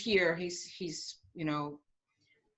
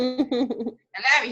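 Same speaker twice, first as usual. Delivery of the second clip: laughing love; 4 semitones higher, 7 dB louder, slower than usual. Acted. Repeats it laughing, slow.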